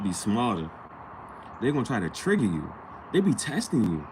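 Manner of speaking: ordinary talk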